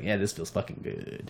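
a quiet, satisfied aside; softly contented. Retold or imitated. About jumping back into Spider-Man, softly contented. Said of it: "it's like".

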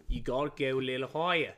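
demonstrating an Australian accent; put-on Aussie accent. Shown as a demonstration. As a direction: show off a broad Australian accent. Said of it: "You're doing British. Here's, here's how you".

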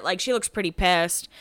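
ordinary talk, nothing marked

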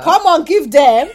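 loud, high, slow egging on; excited hype-up. Real, not acted. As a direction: cheer her on to speak up. Hyping friend to share job.

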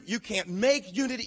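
ordinary delivery

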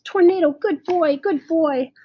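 high, loud praise for a dog; excited yell. A narrator's reading. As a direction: cry out joyfully to your dog. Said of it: "I yelled".

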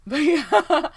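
bursting into high laughter; laughing. Real, not acted. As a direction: laugh the words out loud. Laughing at her own joke.

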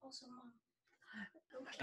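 soft whispered aside; whispering. Real, not acted. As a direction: whisper it with almost no voice. Kid whispers offscreen.

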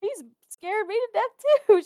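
very high, giggly squeal; retold fright. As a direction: re-enact a scared confession while amused. Retold confession of fright, amid laughter.

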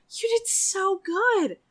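gushing, high-pitched praise; proud praise. Acted. Austin tells his friend he did well, proud and gushing. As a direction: stretch it out, full of pride.

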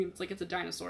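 ordinary talk

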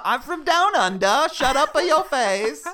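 loud, high mock Australian accent; badly faked Aussie, pushed high and loud; fake Australian accent. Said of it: "what's Australian?".

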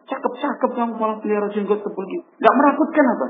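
high, reproachful teasing; mocking father. Father scolding his son.

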